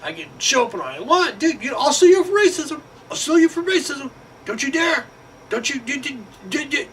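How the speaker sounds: very high, fast hammed-up bragging voice; mock gloating hire. Hired guy bragging about his office, high mocking voice. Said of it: "he's all".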